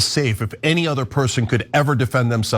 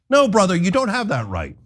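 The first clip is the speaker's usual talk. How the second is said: ordinary talk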